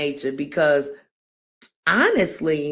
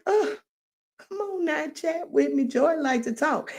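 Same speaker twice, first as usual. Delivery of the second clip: high, softer mock-pleading voice; playful pleading; 11 semitones higher, 3 dB quieter than usual. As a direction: coax them in a high little voice. Playfully begging absent viewers to chat.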